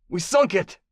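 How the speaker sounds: put-on high, accented, urgent answer; desperate warlord. Captive warlord confesses to interrogator, high and urgent. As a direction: blurt it out under pressure.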